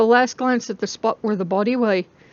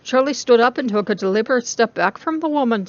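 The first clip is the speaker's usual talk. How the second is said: ordinary delivery, nothing marked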